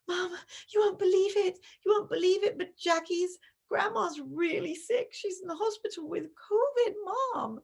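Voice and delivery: put-on high, loud breathless kid blurting news; excited child. Shown as a demonstration. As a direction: play the kid bursting in with bad news.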